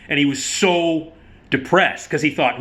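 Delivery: ordinary talk, nothing marked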